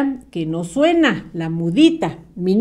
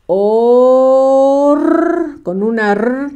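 louder separated syllables for writing; syllable dictation; 3 dB louder, much slower than usual. Shown as a demonstration. Teacher dictating to pupils, syllables called out. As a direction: call out each syllable slowly. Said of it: "¿Listos?".

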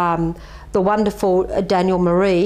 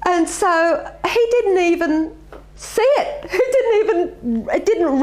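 laughing; 8 semitones higher than usual. Real, not acted. Laughing at the memory.

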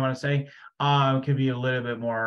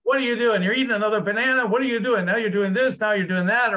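high, louder nagging mom; 8 semitones higher, 4 dB louder, faster than usual. Retold or imitated. Mimicking a helicopter mom scolding her kid. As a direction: fire off criticisms like a fussy mother.

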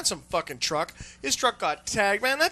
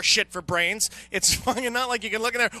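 usual speaking voice

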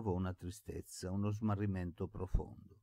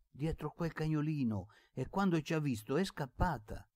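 put-on higher-pitched excited young servant; eager insistence; 8 semitones higher than usual. A narrator's reading. Boy insisting to the old man he saw her, eager. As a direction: press the point eagerly, pitch held high.

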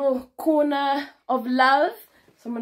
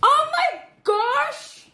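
high, loud, slow drawn-out wow; delighted gasp; 12 semitones higher, 5 dB louder, much slower than usual. Real, not acted. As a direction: stretch it out in amazement. Delighted at gift.

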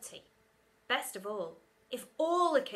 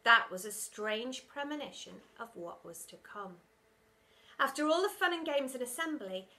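ordinary delivery

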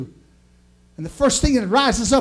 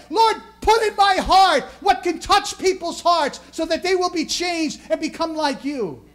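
full-force preaching; 9 semitones higher than usual. Acted. Pastor preaching to the congregation, full-force sermon. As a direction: lift the room, hammer each phrase like a revival preacher. Prays aloud before the sermon.

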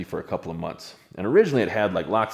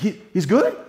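higher, louder shaky question; shaken disbelief; 11 semitones higher, 6 dB louder than usual. Retold or imitated. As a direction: ask in frightened hope. Ryan shaken, asking if Tyler okay.

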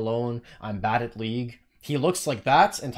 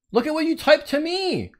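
indignant; 10 semitones higher, 4 dB louder than usual. Real, not acted. Indignant at the player's message.

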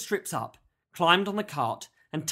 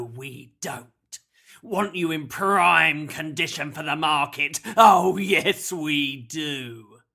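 theatrical kidnapper, swelling to a loud crow; mock-concern, then gloat loudly; sneering villain with a booming catchphrase; 5 dB louder, slower than usual. A narrator's reading. Villain's dialogue in narrated story.

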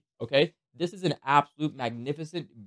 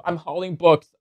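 ordinary talk, nothing marked

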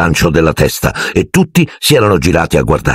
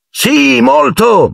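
high, overeager agreement; lightly acted false enthusiasm; much slower than usual. A narrator's reading. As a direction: chirp a polite yes. Children lie politely to host.

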